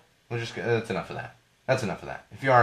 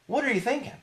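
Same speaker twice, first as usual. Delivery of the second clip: much higher, disbelieving question; incredulous; 13 semitones higher than usual. Real, not acted. Host asking Snapchat again, much higher in disbelief. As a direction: shoot the pitch way up in disbelief.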